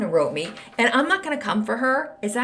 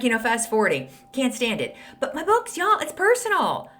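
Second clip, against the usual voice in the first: ordinary delivery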